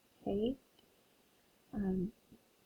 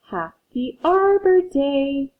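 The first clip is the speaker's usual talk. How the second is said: loud, slow, higher festive call; said loudly; 5 semitones higher, 7 dB louder, much slower than usual. Acted. Dad in the story loudly calling to the family. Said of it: "Dad said loudly".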